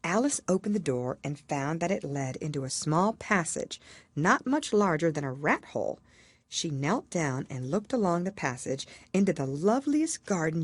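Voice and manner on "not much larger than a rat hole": usual speaking voice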